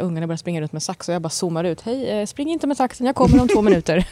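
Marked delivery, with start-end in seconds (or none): 1.8-4.1 retold call to the kids. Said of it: "Och då säger jag så här".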